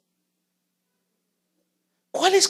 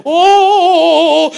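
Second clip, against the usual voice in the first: high, loud mock exclamation; a worshipper raising his voice; raised voice; 11 semitones higher, 10 dB louder than usual. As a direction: belt it out.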